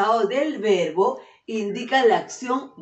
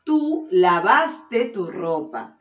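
slow clear repeat; 4 dB louder, slower than usual. Shown as a demonstration. Teacher repeating the sentence for pupils, slow and clear. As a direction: repeat it slowly, each word separate. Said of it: "Escucha bien".

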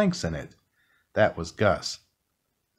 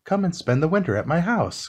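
higher, eager call to the mouse; friendly ghost calling out, a touch higher; cried out; 8 semitones higher than usual. A narrator's reading. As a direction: call out a warm invitation. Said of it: "cried Gus".

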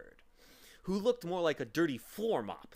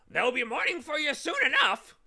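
a much higher, louder squawking bird voice; menacing bird; 12 dB louder than usual. Acted. Dirty bird calling down to the travellers, menacing squawk. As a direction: loom from above and threaten. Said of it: "followed by a hideous cackling laugh".

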